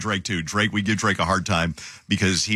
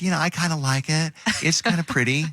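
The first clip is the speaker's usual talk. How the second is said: higher, sweet mock Jenny voice; imitating Jenny; 7 semitones higher than usual. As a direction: chirp it back like kind Jenny defending the shirt. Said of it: "And she'll be like".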